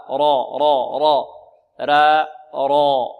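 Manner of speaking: loud drilled را with a heavy r. Shown as a demonstration. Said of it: "لا، را".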